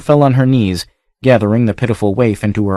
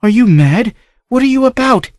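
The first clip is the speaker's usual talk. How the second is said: shocked protest; 14 semitones higher than usual. Acted. Niece confronts raging uncle.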